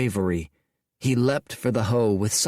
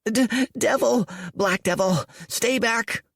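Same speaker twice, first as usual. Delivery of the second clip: put-on high, panicked boy's voice; a scared village boy warding off a devil; terrified boy; 13 semitones higher than usual. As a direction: stammer it out, backing away.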